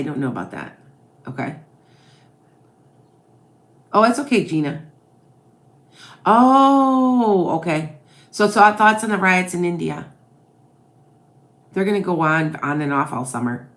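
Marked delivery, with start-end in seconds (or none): none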